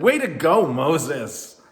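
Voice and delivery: loud, high cheer; shouting. Acted. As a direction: shout it joyfully. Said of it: "they shouted and said".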